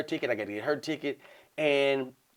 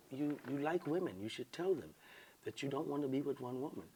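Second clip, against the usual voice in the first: soft-spoken woman; 18 dB quieter than usual. Retold or imitated. Woman nudging him to tell other women, real soft. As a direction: imitate her very quiet voice. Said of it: "Real soft".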